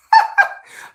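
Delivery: loud, very high laugh; real helpless laugh; high burst of laughter. Real, not acted. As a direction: crack up mid-story. Laughing as phone dies.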